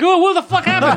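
high, laughing mock outrage; mock shocked viewer. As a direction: blurt it out, laughing in disbelief. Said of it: "And you're like".

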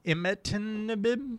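slow, careful Imatinib sounded out; careful pronunciation. Shown as a demonstration. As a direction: say Imatinib slowly, syllable by syllable. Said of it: "I wanna hear you say it".

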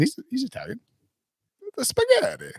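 high, cartoon Italian Mario accent; quick hammed-up Italian accent. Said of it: "more like a Mario accent".

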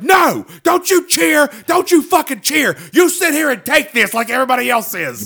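high, loud scolding shout at cheering fans; imitated shout. Comic scolds the cheering crowd, high loud shout. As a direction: order the crowd to stop cheering. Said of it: "He's like".

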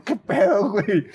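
imitated high, indignant comeback; indignant retort. Diner retelling his reply to a yelling waiter, high indignant retort. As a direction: fire back in an incredulous high voice.

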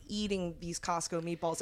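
ordinary delivery

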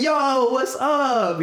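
high, drawn-out excited holler; excited greeting. Retold or imitated. Spotting homies from another podcast, excited greeting. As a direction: greet them with a long, high yo. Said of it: "I'm, like, all excited".

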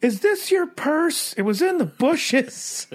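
high, slow mock girly voice; comedy club gal, hammed-up high voice; club worker. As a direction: play her high and slow. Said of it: "She's like".

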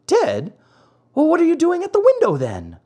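high, rapid puppet question; baffled disbelief. Acted. Pinocchio questions the maiden who says she is dead, baffled. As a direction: blurt it out in high-pitched bewilderment.

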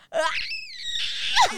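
piercing whistle-register squeal; whistle tone squeak. Shown as a demonstration. Showing friends her whistle register. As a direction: hit the tiny whistle note. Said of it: "here we go".

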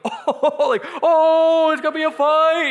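high, loud teasing ooh; excited gasp. Retold or imitated. Family at the table egging on a fight, loud and gleeful. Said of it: "Everyone at my table is going".

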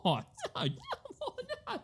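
high squeaky mock denials fading away; prim protest. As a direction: play the prude squealing no. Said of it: "and she'd be like".

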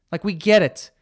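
ordinary talk, nothing marked

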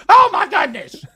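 high, loud laughing exclaim. Real, not acted. Laughing at the memory.

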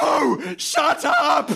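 furious high yell; officer finally snaps; angry shout. Acted. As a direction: shut the reporter down.

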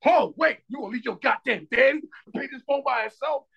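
high-pitched indignant outburst; retold anger. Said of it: "it was anger, like".

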